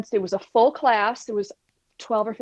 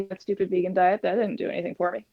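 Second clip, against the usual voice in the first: mock scornful; 3 semitones lower, faster than usual. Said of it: "so that I could say".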